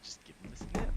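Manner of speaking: breathy whisper. Pretend. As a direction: whisper it like a joke. Silly made-up phrase.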